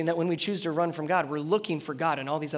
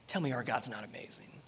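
whisper; 6 semitones lower, 10 dB quieter than usual. Real, not acted. Awed aside to listeners.

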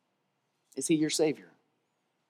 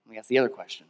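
prayerful altar call. Acted. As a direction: ask it gently as they sit with eyes closed. Reflective questions over music.

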